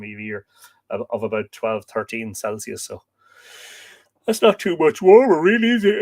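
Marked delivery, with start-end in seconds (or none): 4.3-6.0 talking through a yawn; mid-yawn, stretched words. Real, not acted. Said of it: "Excuse me for the yawning".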